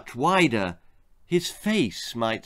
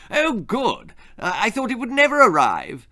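eager delight; 9 semitones higher, 4 dB louder than usual. Acted. Father greets long-awaited parcel.

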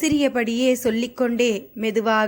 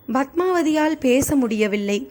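usual speaking voice

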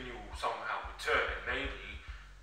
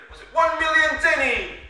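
high, loud character yell; shouted wish; 14 semitones higher, 10 dB louder than usual. Acted. Shu's wish acted again, corrected.

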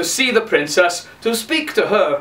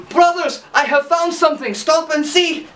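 Hans calling out; 9 semitones higher than usual. Acted.

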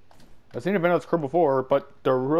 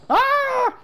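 high shriek; 3 dB louder than usual. Real, not acted. Character falls.

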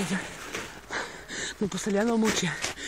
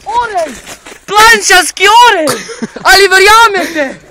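high, loud, drawn-out nature-host amazement; excited shout; 8 semitones higher, 11 dB louder, slower than usual. Acted. Mock survival host spotting an eagle, excited shout. Presenter hails an eagle sighting.

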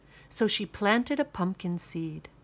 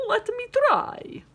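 high vampire voice; 5 dB louder than usual. Acted. Vampire asks to try.

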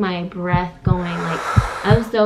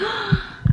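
high gasp; 12 semitones higher, 4 dB quieter than usual. Retold or imitated. Waking from the nightmare.

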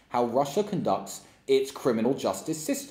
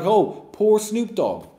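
higher, slow sarcastic whine of pity; mock pity; 8 semitones higher, 4 dB louder, slower than usual. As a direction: mimic dumb people pitying him. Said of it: "dumb people would have been like".

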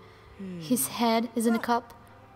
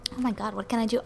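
normal talking voice

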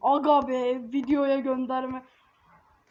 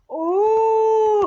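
loud surprised oh; 7 semitones higher, 8 dB louder than usual. Real, not acted. Sudden event in game.

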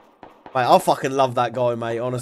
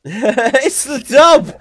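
very loud, slow, higher, drawn out It's the dub with a laugh; with real conviction; 9 semitones higher, 9 dB louder, much slower than usual. Shown as a demonstration. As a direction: bellow It's the dub like you mean it. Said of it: "Say it again".

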